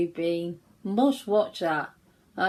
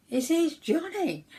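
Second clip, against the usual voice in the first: high-pitched, sure "Here's Johnny"; a touch of know-it-all glee; confident answer; 10 semitones higher than usual. Retold or imitated. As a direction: pop the answer up, triumphant. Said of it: "I went".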